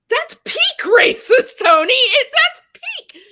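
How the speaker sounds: very high, loud outraged protest; exasperated yell. Retold or imitated. Confronting a silent friend about racism, high exasperated yell. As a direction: re-enact shouting at a friend in disbelief.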